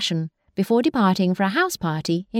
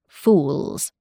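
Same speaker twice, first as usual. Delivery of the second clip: lower, curt scolding; sharp mother bird; 5 semitones lower than usual. Acted. Mother sparrows scolding their chicks, sharp and low. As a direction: snap the word down low. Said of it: "their mothers answered sharply".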